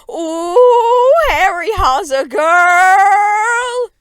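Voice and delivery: very high, slow shrieking wail; screaming fanboy. A narrator's reading. Louis shrieking about the girl cousin, high slow wail. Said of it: "Lois screamed".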